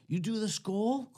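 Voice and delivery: high, rising question; imitated curious hippie. Hippie asking teen about dip, imitated curiosity. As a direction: lift it into an intrigued question.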